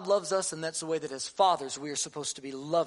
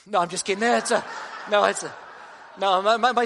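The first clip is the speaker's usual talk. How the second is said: laughing; 8 dB louder, faster than usual. Real, not acted. Joking with congregation, laughs through it.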